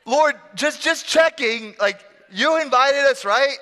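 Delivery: high, comic checking-in; awkward Peter. Acted. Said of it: "He says".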